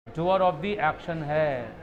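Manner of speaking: very slow, word by word; slow dictation. Shown as a demonstration. Teacher slowly answers his own question for students. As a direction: drag out each word like dictating. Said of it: "बताओ आपका nature क्या था".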